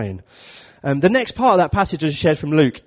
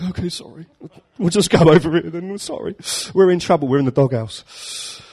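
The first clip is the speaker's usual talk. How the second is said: a hint of sheepish, higher apology; sheepish disciples; 4 semitones higher than usual. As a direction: shuffle off lightly voiced, chastened. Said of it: "His disciples was like".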